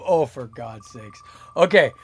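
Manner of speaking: ordinary talk, nothing marked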